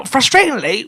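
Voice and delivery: usual speaking voice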